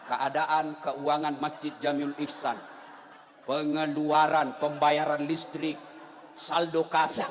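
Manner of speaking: low, very slow monotone reading; reading notices aloud. Retold or imitated. Bored worshipper reading the mosque wall during prayer, slow low drone. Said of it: "terpaksa kita baca semua pengumuman".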